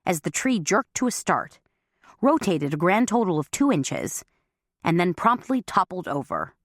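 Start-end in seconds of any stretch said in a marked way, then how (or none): none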